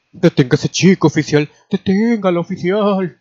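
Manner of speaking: high, loud demand rising in pitch; urgent calling out. A narrator's reading. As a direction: shout for the officer to stop him. Old lady urgently calling an officer.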